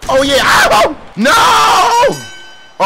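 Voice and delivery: very high, loud drawn-out scream; screamed dismay. Real, not acted. Gamer as the match turns on him, screamed dismay. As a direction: shriek from cheer into alarm.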